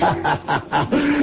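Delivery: coarse laugh. Acted. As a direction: laugh like a vulgar rogue. Villain laughs at tavern table.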